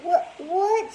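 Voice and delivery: high, stunned stammer; shocked. A narrator's reading. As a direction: sputter in shock. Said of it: "she asked".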